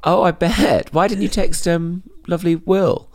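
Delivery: high, slow mock-sweet teasing. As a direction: coo it up high like you're ribbing a friend. Teasing friend about not texting.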